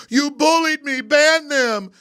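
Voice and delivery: high, loud whining mock voice; whiny mock complaint. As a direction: whine like a spoiled brat. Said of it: "they go".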